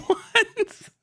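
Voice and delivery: usual speaking voice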